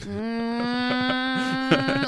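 long, high, rising "mm"; imitated strained groan. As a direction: hold a straining hum, imitating someone dazed. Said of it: "the David after Dennis".

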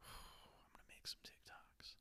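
slow breathy whisper; hushed whispered promise. Real, not acted. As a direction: whisper it slowly to yourself. Whispered after sigh.

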